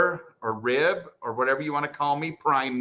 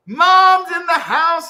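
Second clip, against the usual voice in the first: high, stretched celebratory shout; excited announcement; 14 semitones higher, 10 dB louder, slower than usual. Real, not acted. Streamer announcing that Mom joined, shouting excitedly. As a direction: holler it like a big welcome.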